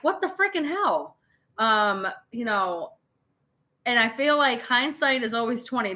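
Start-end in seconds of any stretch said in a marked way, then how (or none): 0.0-1.2 much higher mock outrage; shocked disbelief. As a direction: squeal it in stunned disbelief. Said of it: "That they're like".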